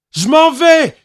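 put-on high, loud child's shout; boy shouting defiance; cry out. A narrator's reading. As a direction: yell it as he leaves. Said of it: "cria-t-il".

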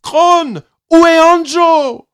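high, loud, urgent call; frightened mother calling loudly; alarmed call. A narrator's reading. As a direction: call out in alarm for a missing son.

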